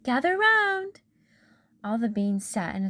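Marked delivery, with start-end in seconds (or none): 0.0-1.0 high, loud call; hollering. Acted. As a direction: call everyone over. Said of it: "she hollered".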